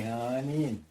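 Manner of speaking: "Hanin" said as HAH-neen, falling; Ojibwe word. Shown as a demonstration. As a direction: say "Hanin" with stress on the first syllable. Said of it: "we say".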